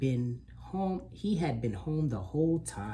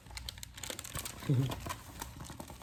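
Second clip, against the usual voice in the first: soft breathy laugh; 7 dB quieter than usual. Real, not acted. Reader laughs after punchline.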